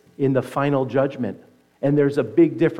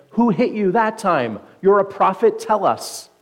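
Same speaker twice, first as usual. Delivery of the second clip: raised, mock taunting of a prisoner; 6 semitones higher, 5 dB louder than usual. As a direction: taunt him, voice pitched up and louder. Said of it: "mockingly said".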